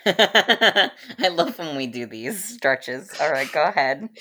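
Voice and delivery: laughing. Real, not acted. Laughing at the stretched segue.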